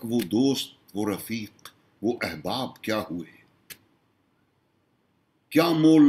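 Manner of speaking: measured Urdu nazm recital, pitched above his talk; declaimed mushaira-style poetry; poem recitation. Acted. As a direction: recite it as verse, pausing after each line.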